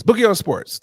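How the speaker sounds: loud, high hype intro; segment announcer. Acted. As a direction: announce the segment title loudly.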